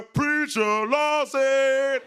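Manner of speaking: loud, high, very drawn-out shout; calling out. Retold or imitated. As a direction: holler the name from afar. Dude out of nowhere calling out.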